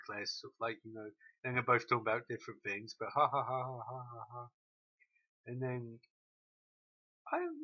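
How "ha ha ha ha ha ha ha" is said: spelled-out fake laugh; mock laugh. Real, not acted. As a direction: laugh it off in a stilted beat.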